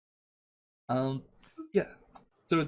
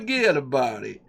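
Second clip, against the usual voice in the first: put-on New York accent; 4 dB louder than usual. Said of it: "I'm accenting here".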